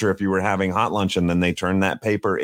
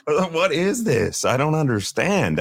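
put-on confused kid voice; 9 semitones higher, slower than usual. Voicing confused foreign kid.

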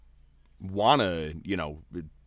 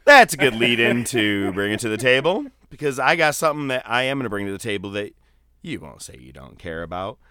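louder quoted lead-in; acted-out line; 3 semitones higher, 9 dB louder than usual. Host reenacting the line he was going to say, acted out louder. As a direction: deliver it as a louder prepared line. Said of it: "and I go".

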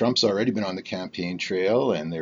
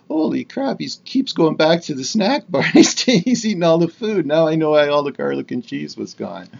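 loud, high-pitched imagined astonishment, laughing midway; amused mock outrage; 8 semitones higher, 8 dB louder than usual. Imagined viewers spot him raiding snacks.

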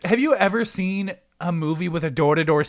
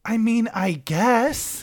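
put-on slow, higher, grudging girlfriend voice; reluctant girlfriend; 7 semitones higher, slower than usual. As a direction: drag it out, half-hearted. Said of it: "And this girl is like".

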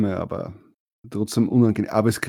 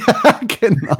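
laughing; 9 semitones higher than usual. Real, not acted. Laughing at the Meetup joke.

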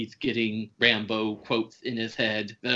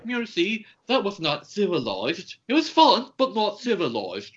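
Brain Gremlin; 9 semitones higher than usual. Retold or imitated. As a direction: play the smug, prim monster scolding. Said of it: "He's like".

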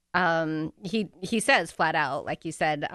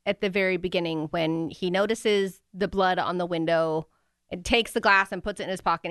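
ordinary delivery

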